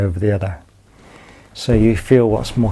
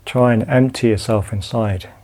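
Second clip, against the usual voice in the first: calm, unhurried body-scan guidance with long pauses; guided meditation. Acted. Teacher guiding students lying down through a body scan, calm meditation voice.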